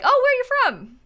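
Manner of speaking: high, loud imitation of a nosy question; mock curious stranger. Strangers asking about his accent, mock curious. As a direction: imitate an eager stranger asking, pitched well up.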